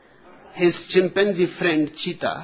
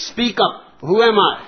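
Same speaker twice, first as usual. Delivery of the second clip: higher, louder, quick mock interrogation; angry demand; 6 semitones higher, 8 dB louder, faster than usual. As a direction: demand an answer, loud and fast, like a storybook bully. Angry Tarzan interrogates the giraffe.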